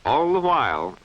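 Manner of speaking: ordinary delivery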